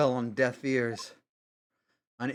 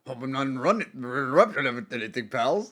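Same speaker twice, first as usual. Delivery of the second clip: loud, higher, pushy detective voice; brash detective; 4 semitones higher, 8 dB louder than usual. Acted. Detective barging in on the lawyers, brash loud voice. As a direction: barge in like a cocky cop.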